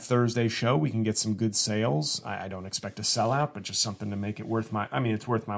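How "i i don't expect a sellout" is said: ordinary talk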